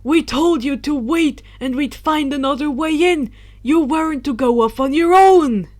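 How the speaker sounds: put-on loud scolding old wizard voice; stern exclaim. Dumbledore scolding Luna for wandering off, loud stern voice. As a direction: scold her loudly, relieved and cross.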